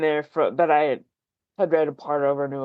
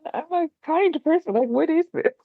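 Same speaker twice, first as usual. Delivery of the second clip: baffled self-quote; 12 semitones higher than usual. Retold or imitated. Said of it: "And it's just like".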